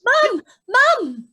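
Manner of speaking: shouted call for Mum. Acted. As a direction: call out from offstage. Son calling out to mother.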